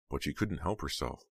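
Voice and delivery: usual speaking voice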